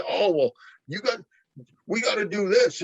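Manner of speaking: mock whining cheater. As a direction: mimic a cheater nagging the ref.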